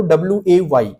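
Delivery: slow, separate letters W ए वाई टी ओ; spelled letter by letter. Shown as a demonstration. Typing teacher demonstrates reading "way to", slowly spelled. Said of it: "ऐसे पढ़ो".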